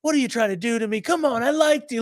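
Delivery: high, whiny mock plea; high whine. The scammed man pleading with the girl, high and whiny. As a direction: pitch it up like a wounded victim.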